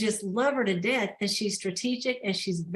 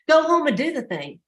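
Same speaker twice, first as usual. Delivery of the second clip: higher-pitched, playful no-nonsense push; matter-of-fact order; 6 semitones higher, 4 dB louder than usual. Retold or imitated. Blunt mentor pushes her toward her goals, mock bossy. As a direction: imitate a blunt mentor sending someone off to work. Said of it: "she's a real matter of fact girl".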